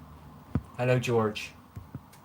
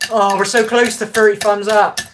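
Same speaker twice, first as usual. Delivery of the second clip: excited; 10 semitones higher, 11 dB louder than usual. Real, not acted. Excited about likes count.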